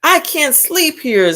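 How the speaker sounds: high, whiny little ladybug. A narrator's reading. Teacher voicing the ladybug complaining to the tiger. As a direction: squeak it like a tiny tired bug. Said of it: "said the lazy ladybug".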